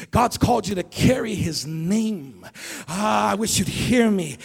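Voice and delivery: usual speaking voice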